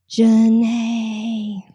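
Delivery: slow raspy ghostly whisper of a name. Acted. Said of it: "I love your ghost voice".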